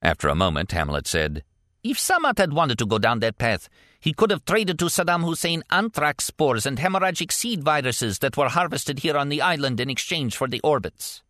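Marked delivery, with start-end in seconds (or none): 1.8-11.2 a touch higher warlord voice, musing; a mad warlord speculating aloud, lightly played. A narrator's reading. Warlord speaks again.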